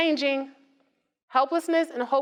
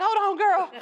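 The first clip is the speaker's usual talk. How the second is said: laughing through a mock excuse; laughing imitation of a friend with a messy car; 8 semitones higher than usual.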